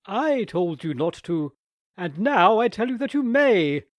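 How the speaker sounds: loud, rising impatient aunt. A narrator's reading. Trapped aunt losing patience with Nicholas, impatient. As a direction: snap the permission out. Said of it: "rather impatiently".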